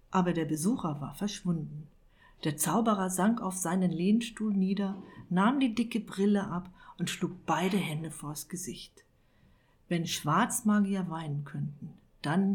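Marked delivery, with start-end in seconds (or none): none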